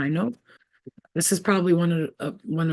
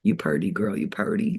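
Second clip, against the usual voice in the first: put-on lightly drawled "You purty, girl" with a low start; hint of a southern compliment; mock country drawl; 8 semitones lower than usual. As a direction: tease it in a folksy twang. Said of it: "be like".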